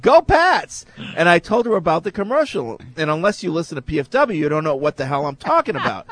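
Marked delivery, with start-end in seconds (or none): 0.0-0.8 high, loud mock cheer; retold yell. Listener repeats his Patriots cheer to a Jets-fan waitress, yelled. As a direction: belt it out like a quoted shout. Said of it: "Why do I keep yelling out".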